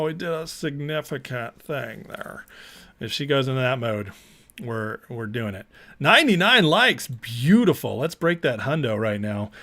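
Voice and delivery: normal talking voice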